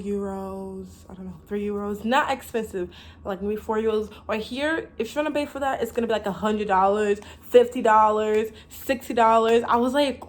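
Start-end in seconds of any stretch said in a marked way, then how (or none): none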